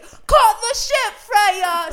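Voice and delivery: very high, drawn-out mock-British yell; Joe screaming. Boyfriend shuts down girlfriend's protest in the cab, screaming in British accent. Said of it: "you're screaming at me in the Uber".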